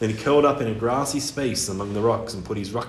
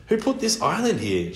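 mermaid voice; 7 semitones higher than usual. Acted.

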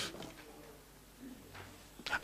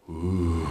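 low soft hushed ooh; a low worried crowd gasp; 11 semitones lower, 9 dB quieter than usual. Acted. Crowd watching the walker wobble: low soft ooh.

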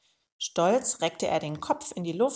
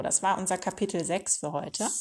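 ordinary delivery

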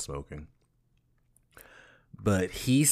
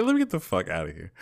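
quick, louder retold urge to flee; mock panic; 5 dB louder, faster than usual. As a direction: play a hurried, half-laughing panic. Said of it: "I was starting to panic".

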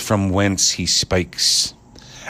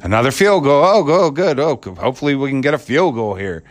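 sarcastic, higher mock cheer; complaining fans; 6 semitones higher than usual. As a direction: fake delight at another field goal. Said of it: "fans complaining with the Bears".

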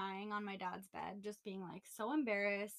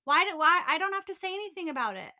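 heated rant; 7 semitones higher, 12 dB louder than usual. Real, not acted. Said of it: "Pisses me off".

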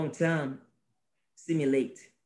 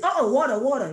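raised, lively mock call of "water"; acting out offering water; 8 semitones higher, 6 dB louder than usual. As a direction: hold out "water" like to a thirsty stranger. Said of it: "you come like this".

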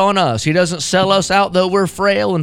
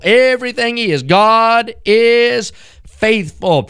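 higher, louder climax; preaching at full force; 7 semitones higher, 4 dB louder than usual. Acted. Preacher crying out to listeners at the climax, much higher and louder. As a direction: lift the congregation with a ringing cry.

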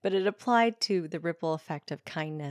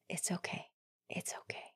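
whispered comfort, barely voiced; gentle whisper; 15 dB quieter than usual. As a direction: hush him like a frightened child. [name] comforting boy, whispered.

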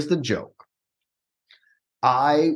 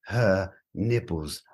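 low, very slow chef verdict; hushed, damning critic; Gordon Ramsay impression; 9 semitones lower, 6 dB quieter, much slower than usual. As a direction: pronounce it slowly and softly, word by word. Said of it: "he would be like".

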